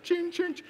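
high ringing "ching" noises; imitated register chime. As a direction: imitate a till ringing up a bill. Imitates the bill going up.

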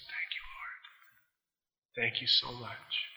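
breathy, whispered thank-you prayer; slow, hushed, almost unvoiced thanks. Preacher retelling a man's whispered prayer of thanks.

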